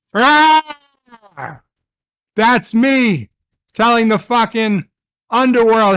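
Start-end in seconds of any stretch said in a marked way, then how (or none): 0.1-1.6 high, loud burst of a yell; frustrated scream. Real, not acted. Fan screaming at dying Star Trek, high loud yell. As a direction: let out a scream of pure fan rage. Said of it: "I'm gonna fucking scream".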